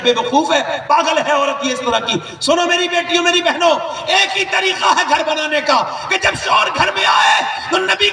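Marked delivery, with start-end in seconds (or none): 2.4-8.1 a preacher's full-voiced shout over the crowd; fiery preaching. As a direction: thunder it out to the daughters and sisters. Preacher addresses daughters, full force.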